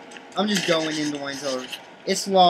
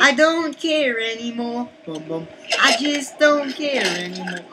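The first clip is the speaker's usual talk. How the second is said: put-on voice; 4 semitones higher than usual.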